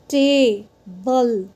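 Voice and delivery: "Table" slowly, first syllable stretched and strong; long, loud vowel. Shown as a demonstration. Teacher demonstrating the vowel saying its name, "table" with long loud A. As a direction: hold the ay of "table", then separate the second syllable. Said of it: "A is long and loud".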